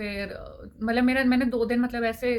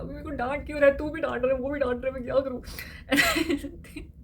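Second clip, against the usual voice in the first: higher, pleading whine; whiny protest; 4 semitones higher than usual. Retold or imitated. Daughter whining at friend who also scolds her, near tears. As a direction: voice breaking toward tears, begging everyone to stop.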